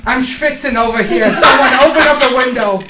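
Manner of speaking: put-on high, loud complaining old man. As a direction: kvetch loudly like an old grandpa.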